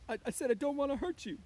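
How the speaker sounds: high, loud, stammered protest; anxious Lenny. A narrator's reading. Lenny to the woman lying still, anxious.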